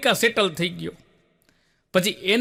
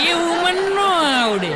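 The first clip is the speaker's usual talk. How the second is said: much higher, louder, laughing woman's voice; mock woman's voice; 10 semitones higher, 4 dB louder than usual. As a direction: squeal it like a coy auntie. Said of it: "એક જણી શું કે".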